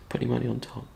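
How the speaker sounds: quiet, incredulous repeat of his words; hushed what-did-you-just-say; disbelieving echo. Retold or imitated. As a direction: echo it back half under your breath, stunned.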